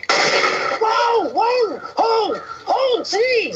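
high, panicked whoa; panicked yelling. Acted. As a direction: shout in sudden alarm. Gun pointed at him.

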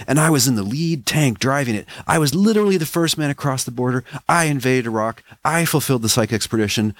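high, fast excited soldier; Speedo. Acted. Soldier Speedo's boastful rant.

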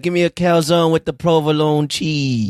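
hammed-up New York Italian deli order; New York accent. Co-host ordering a calzone, mock New York Italian accent. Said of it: "like, a New York accent".

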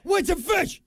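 high, incredulous yelp repeating the slip; mocking echo. Real, not acted. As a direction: pounce on the mistake with a high squeak. Mocking partner's misread.

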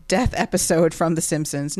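ordinary talk, nothing marked

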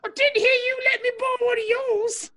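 high, lightly put-on British voice; a hurt Brit who was in a pinch; mock British. Answering a jab about his robe, light mock British accent.

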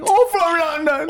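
very high, drawn-out fake London accent; hammed-up Londoner impression; mock English accent. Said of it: "your English accent".

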